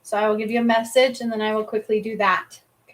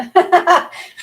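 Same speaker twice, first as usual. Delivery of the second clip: loud laugh; 8 semitones higher, 10 dB louder than usual. Real, not acted. Laughing at worn-out deck.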